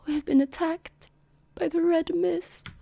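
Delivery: high, strained, slowing voice; distressed messenger. Acted. Rider reporting an attack to the commanders, high-voiced and distressed.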